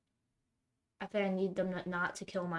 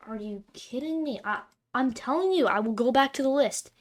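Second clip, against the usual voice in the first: frustrated outburst; 4 semitones higher, 8 dB louder than usual. Real, not acted. Frustrated at opponents' plays.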